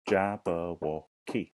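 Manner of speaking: "Jabberwocky" very slow, in four separate beats; syllable by syllable. Shown as a demonstration. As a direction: sound out "Jabberwocky" with gaps between syllables. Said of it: "Let's hear it again".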